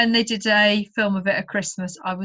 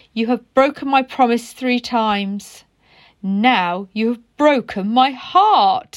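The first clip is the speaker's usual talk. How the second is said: louder, slow heartbroken reproach; heartbroken caterpillar; 5 dB louder, slower than usual. Acted. Caterpillar tells tadpole he broke her heart, slow and hurt. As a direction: let the heartbreak land slowly. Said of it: "said the caterpillar".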